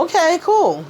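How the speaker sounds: high, breezy brush-off with a falling cool; mock unimpressed. [name] unimpressed by the plastic flower, high and breezy. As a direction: toss it off up high.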